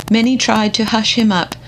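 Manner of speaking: ordinary delivery, nothing marked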